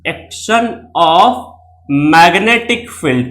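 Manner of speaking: very slow, word by word; slow dictating pace; slow dictation. Shown as a demonstration. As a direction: spell it out slowly for notes. Teacher dictating key phrase slowly.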